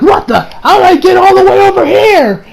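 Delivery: high, loud mock bewilderment; bewildered outburst. Retelling a character flung into another room, bewildered outburst. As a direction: act out someone suddenly confused, pitch leaping.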